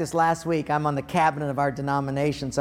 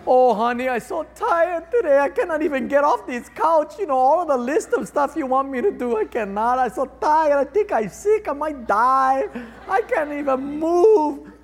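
mock whiny tired; 10 semitones higher, 4 dB louder than usual. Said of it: "We do it all the time".

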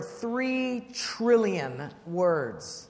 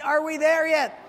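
put-on higher, louder whiny child; nagging kid; 6 semitones higher, 5 dB louder than usual. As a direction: whine it like a bored little kid. Kids kicking the seat.

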